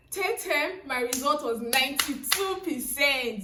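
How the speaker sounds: loud, excited big reveal; triumphant announcement. Real, not acted. Telling viewers her best result, loud and triumphant. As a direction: proclaim the winning score loudly.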